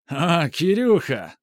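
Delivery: put-on drunk, laughing greeting; slurred, tipsy and jovial; drunken laugh. A narrator's reading. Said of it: "он засмеялся пьяным смехом".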